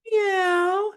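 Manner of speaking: very high squeaky cat meow; a tiny kitten mewing up high; kitty meow. Acted. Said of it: "and he said".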